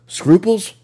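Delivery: sarcastic. Real, not acted. Sarcastic punchline.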